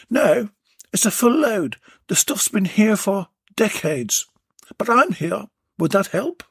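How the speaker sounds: lightly voiced woman; eager lady. A narrator's reading. Belinda voiced in dialogue.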